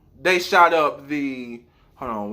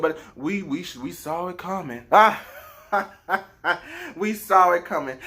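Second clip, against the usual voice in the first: normal talking voice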